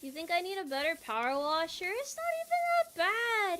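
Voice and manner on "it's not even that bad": much higher squeaky denial; squeaky defensive voice. Pretend. Defensive about washer.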